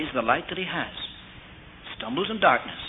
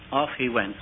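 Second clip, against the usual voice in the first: usual speaking voice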